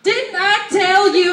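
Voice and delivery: loud, slow mock scolding; imitating mom yelling. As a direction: draw out each word like a scolding mother. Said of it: "yell at us".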